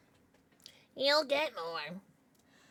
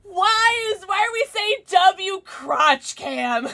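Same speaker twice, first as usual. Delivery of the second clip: exasperated shout; 7 semitones higher, 7 dB louder, much slower than usual. Real, not acted. Chat spamming crotch cam.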